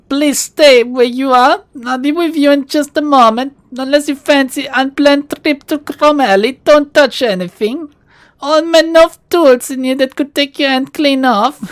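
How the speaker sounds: put-on pleasant, cultured Russian-accented shopkeeper warning a visitor. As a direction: play the polite Russian shopkeeper, hamming the accent. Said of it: "There's a hint of a Russian accent".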